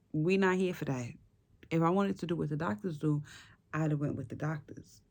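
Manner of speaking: ordinary delivery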